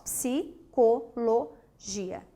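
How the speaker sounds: Psicologia broken slowly into psi, co, lo, gia; slow Psicologia with a gap after each syllable; syllable-by-syllable split. Shown as a demonstration. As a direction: say Psicologia one syllable at a time. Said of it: "Agora eu consigo fazer a separação de sílaba".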